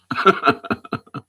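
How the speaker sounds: short burst of laughter; real laugh. Real, not acted. Host hearing praise of their musical talent, laughing. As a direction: laugh out in quick pulses.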